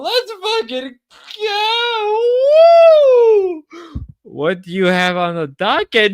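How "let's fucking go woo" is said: excited shout. Real, not acted. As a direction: yell it out, pumped up. Hyping up stream while drunk.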